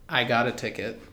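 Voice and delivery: ordinary talk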